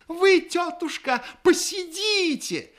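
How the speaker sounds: very high, slow clown patter; loud showman shouting over a crowd; booming circus ringmaster. Acted. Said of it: "сказал ей хозяин".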